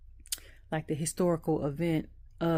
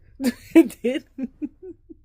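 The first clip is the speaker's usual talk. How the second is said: sudden loud laugh, much higher; 9 semitones higher, 13 dB louder than usual. Real, not acted. Vlogger laughing at her own joke to viewers, a loud burst.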